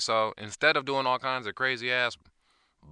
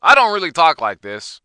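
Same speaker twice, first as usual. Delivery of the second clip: higher show voice with overdone inflections; exaggerated inflections; 8 semitones higher, 17 dB louder than usual. Shown as a demonstration. Host admitting the voice is put on, in exaggerated inflections. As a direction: lean into silly announcer inflections. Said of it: "using my voice with these stupid-ass inflections".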